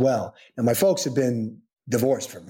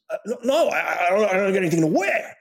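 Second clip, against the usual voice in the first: higher, stammering imitation of dad; flustered refusal; 7 semitones higher, faster than usual. Dad declining his son's Emmys invitation, flustered. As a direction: stumble over a mock-flustered excuse.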